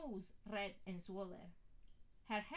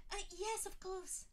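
high, flustered gabble; startled receptionist flustered, high gabble; gabbling witch; 11 semitones higher than usual. A narrator's reading. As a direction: caught off guard, babble it. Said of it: "she gabbled".

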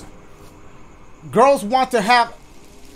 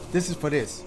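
ordinary talk, nothing marked

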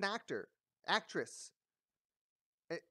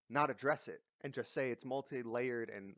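ordinary delivery

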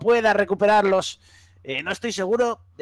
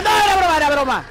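panicked; 10 semitones higher, 5 dB louder, much faster than usual. Acted. Panicked shouted retraction.

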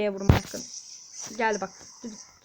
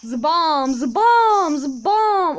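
loud hit sounds; 2 semitones higher, 7 dB louder, much slower than usual. Shown as a demonstration. Gamer voicing his hero's combo, loud Zıbam. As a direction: shout Zıbam on each blow, building up. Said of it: "zıbam diye vuruyoruz".

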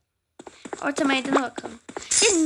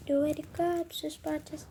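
ordinary talk

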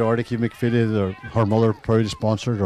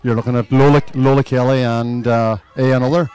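excited; 2 semitones higher, 11 dB louder than usual. Real, not acted. Commentator shouts about a player.

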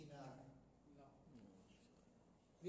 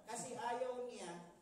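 ordinary talk, nothing marked

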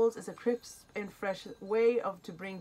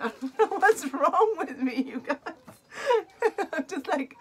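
high teary laugh through the words; giddy, choked-up laughter; emotional laughing; 10 semitones higher, 8 dB louder than usual. Real, not acted. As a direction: laugh at your own tears. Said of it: "I'm getting emotional again".

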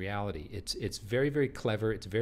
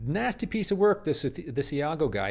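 much higher and louder, relishing the insult; disgusted verdict; 6 semitones higher, 6 dB louder than usual. Real, not acted. Teacher to class about Iago, loudly disgusted. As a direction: fling the judgement out.

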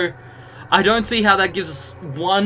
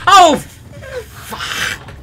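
sudden much higher, louder curse; frustrated outburst; 6 dB louder than usual. Real, not acted. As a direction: blurt it out as the game goes wrong. Loses progress in the game.

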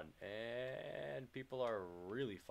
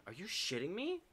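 incredulous; 10 semitones higher than usual. Real, not acted. Controller dies mid-game.

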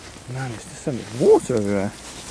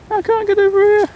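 much higher and louder squeaky whine; high mock squeal; 5 dB louder than usual. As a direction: squeal it like a cartoon. Repeats line, second take squeaked.

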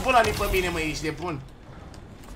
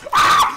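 very high, loud scream; short piercing yelp of alarm; sudden scream; 14 dB louder than usual. Real, not acted. Sudden scream during game fight. (țipăt scurt, foarte înalt)

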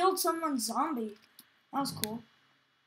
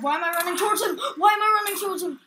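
fast, rising alarm, getting louder and higher; panicked; 6 semitones higher, 6 dB louder, faster than usual. Real, not acted. Player running at a zombie by mistake, panicked. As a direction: rattle it out in a loud, high panic.

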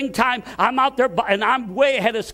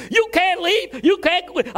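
higher, mock angry yell down the hall; 6 semitones higher than usual. Boss chasing his quitting janitor down the hallway, mock yell. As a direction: call after him like a boss losing his worker. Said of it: "he yelled at me".

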